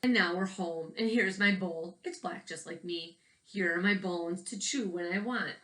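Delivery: put-on gentle storytelling read for young listeners; picture book narration. A narrator's reading. Teacher reading a picture book to children, storytelling voice. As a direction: read the book aloud to the kids, evenly paced.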